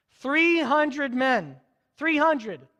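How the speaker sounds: "Three hundred men" said very slowly, each word leaned on; emphatic repeat. Real, not acted. Preacher stressing how few men, very slow. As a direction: drag out "Three hundred" for weight. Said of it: "stressing the tiny army".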